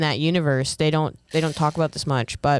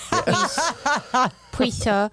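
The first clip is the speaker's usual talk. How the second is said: put-on big baby pleading; 9 semitones higher, much slower than usual.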